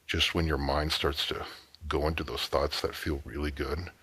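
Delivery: soft, low-key intimate coaxing with long pauses; seductive hypnotic suggestion. Acted. Man talking a woman through anticipation, softly seductive. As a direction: lull the listener, pausing between phrases.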